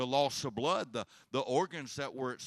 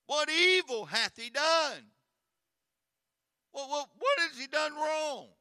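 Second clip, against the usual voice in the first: much higher, loud pleading question; desperate loud plea; 9 dB louder than usual. Acted. Pilate begs the crowd to explain, loud and pleading. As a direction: cry out in bewildered protest.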